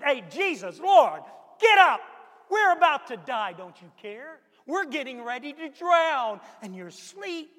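loud, high yell to wake him up; hollering over the storm at a sleeping Lord; panicked shout. Acted. Peter shouting to wake Jesus in the storm, panicked yell.

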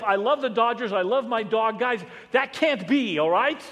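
loud, high, driving sermon voice; full-force preaching. Acted. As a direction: preach it hard to the congregation. Preaching on the word love.

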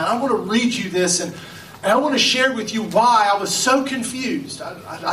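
ordinary talk, nothing marked

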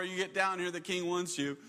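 ordinary talk

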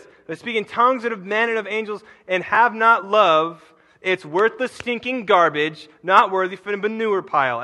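impassioned street-preacher paraphrase; fiery paraphrase. Shown as a demonstration. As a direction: hammer the paraphrase home. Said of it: "this is what that voice says in my head".

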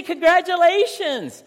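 high, loud congratulations; a job offer blurted over the phone; excited congratulations. Acted. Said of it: "And they say".

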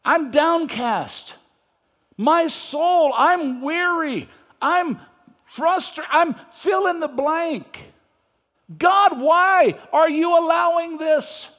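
high, slow, loud complaint to God; whining prophet. Acted. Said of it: "He's still whining".

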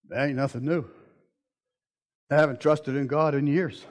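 low, soft, offhand mock voice; mock shrug. Preacher imitating a jaded shrug at the coin, low and offhand. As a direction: brush it off like it's old news.

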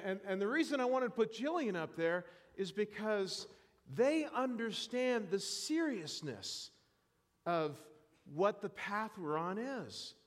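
ordinary talk

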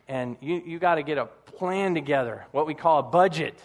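ordinary talk, nothing marked

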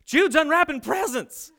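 high, loud tattletale voice with a laugh; tattling kid. Retold or imitated. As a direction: announce the crime gleefully. Tattling big brother runs in.

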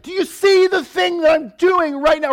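loud, high mock-furious parent shout; hammed-up parent outburst; exasperated shout. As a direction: yell it like a frazzled parent at the stove. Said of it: "I'm like".

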